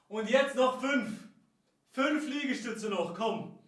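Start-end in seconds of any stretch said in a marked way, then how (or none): none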